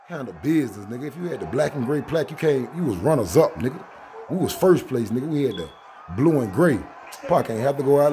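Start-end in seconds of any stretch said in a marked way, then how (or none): none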